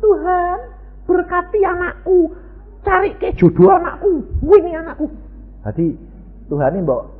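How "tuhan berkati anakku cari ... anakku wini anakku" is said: high, louder voice of a believer ordering God; mock demanding prayer. Preacher mimics believers ordering God, high and mocking. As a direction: parody a bossy prayer. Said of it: "Contohnya apa?".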